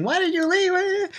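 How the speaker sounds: very high mock whine; imitating Shirahoshi. Princess protests crew leaving, high whiny imitation. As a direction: plead in a squeaky put-on princess voice. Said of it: "on top of them saying".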